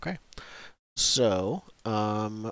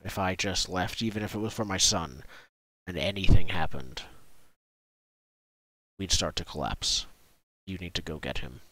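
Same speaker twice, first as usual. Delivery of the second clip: grave village chief; 5 semitones lower than usual. Acted. Chief explaining why he can't leave the village, gravely. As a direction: lower the voice into a firm plea.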